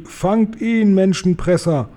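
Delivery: high-pitched giant's cry to a mate; storybook shout, pitched well up. A narrator's reading. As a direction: call it out high, stretching the opening words.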